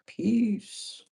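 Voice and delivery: a quick drawn-out sign-off like a sitcom character; Martin's P M voice. Retold or imitated. Signing off from work in Martin's P M voice. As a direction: toss off peace like Pam from Martin. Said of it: "in my P M voice from Martin".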